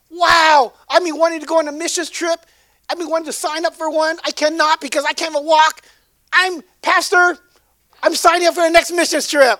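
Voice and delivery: a hammed-up, overjoyed cry of thanks; excited healed woman. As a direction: burst out with joy like you can walk again. Healed woman thrilled, signing up.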